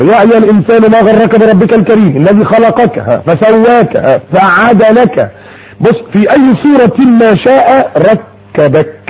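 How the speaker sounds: slow, lifted recitation tone; Quran recitation. Acted. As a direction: recite the verse with reverent drawn-out lines.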